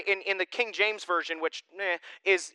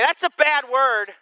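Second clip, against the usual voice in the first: put-on child's scolding; 8 semitones higher, 9 dB louder, slower than usual. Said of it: "Jax would look at you and say".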